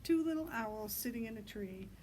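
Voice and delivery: ordinary delivery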